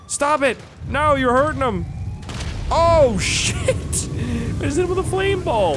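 veel hoger en luider schreeuwen, met een lach erdoor; panicked shouting. Real, not acted. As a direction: gil het uit van schrik. Dragon hurting ally, shouting.

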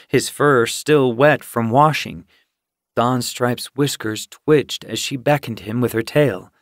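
ordinary delivery, nothing marked

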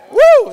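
loud high cheer; whoop. Real, not acted. Pastor cheering his Astros, loud whoop. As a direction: whoop like a fan.

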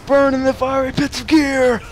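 high, loud mock doom cry; hammed-up villain shout. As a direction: bellow the curse like a cartoon villain.

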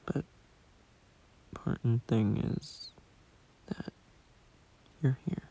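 strained, failing voice with breaks into whisper; voice giving out. Real, not acted. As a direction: push through a tired, worn-out throat. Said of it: "my voice box is starting to give out".

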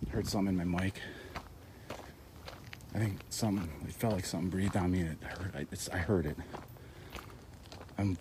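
normal talking voice

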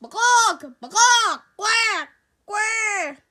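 loud mocking monkey and duck calls; animal noises. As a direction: squawk like silly animals. Kids making animal noises.

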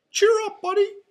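very high, loud cartoon mouse voice; Mickey. A narrator's reading. Mouse cheering up his friend, high cheery squeak. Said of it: "Mickey said".

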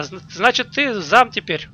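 usual speaking voice

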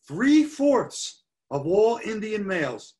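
"three-fourths" slow, high and forceful; stress on three-fourths. Real, not acted. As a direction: stretch and punch "three-fourths" as the critical figure. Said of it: "So I want to stress that".

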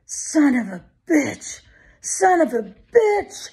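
high, drawn-out anguished cry; thin wail of defeat, stretched out; feeble despairing cry. A narrator's reading. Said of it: "Capers cried out feebly".

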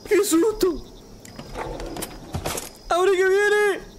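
high, panicked cries; scared. Real, not acted. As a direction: shriek from the jump scare. Monster chasing him.

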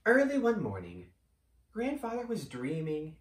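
bedtime story narration, hushed at the end; storytelling. A narrator's reading. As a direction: tell it like a tale for kids.

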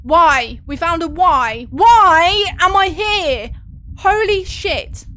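loud, slow, frightened wailing; scared loud cry. Real, not acted. Frightened by the game.

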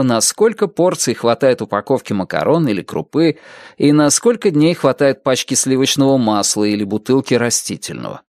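ordinary delivery, nothing marked

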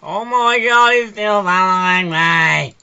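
high, loud, drawn-out wail; desperate yell. Real, not acted. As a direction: scream in exasperation at being chased. Still being chased in game.

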